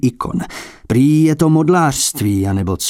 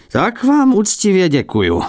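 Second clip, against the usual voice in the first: put-on higher, offended old abbot bursting out; huffy blurt; 8 semitones higher than usual. A narrator's reading. Abbot bursts out, offended by the collector's excuse. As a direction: blurt it out, cheeks puffed in offence. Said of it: "vyhrkl opat a nadul popuzeně tváře".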